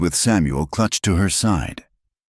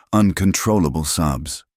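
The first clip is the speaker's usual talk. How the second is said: ordinary delivery, nothing marked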